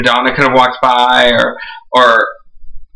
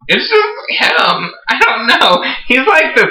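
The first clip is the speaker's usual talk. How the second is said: swooning gush; 11 semitones higher, slower than usual. Real, not acted. Gushing over a crush.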